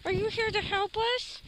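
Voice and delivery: high sing-song greeting to a dog; talking to a dog. Real, not acted. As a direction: coo at the dog.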